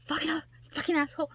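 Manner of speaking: high, loud, cursing at himself in frustration; mock angry outburst. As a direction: yell at yourself for flubbing a line, hammed up. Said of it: "you're like".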